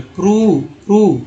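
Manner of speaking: प्रूव with a long, drawn "oo" vowel; long oo demo. Shown as a demonstration. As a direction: model प्रूव firmly with "proo" sound. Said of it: "यह उ पढ़ते हैं".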